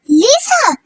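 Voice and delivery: high call across the house. Acted. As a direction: call her name to draw her out. Calling out for Lisa.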